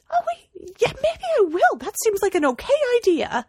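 high, light, offhand musing; hammed-up breezy artist voice; mock casual Moore. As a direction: pretend to discover the idea. Alan Moore mocked casually.

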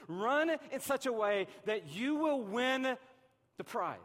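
"Run in such a way that you will win" pushed out loud and slow; urgent call. Shown as a demonstration. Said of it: "Don't even just do two thousand fourteen".

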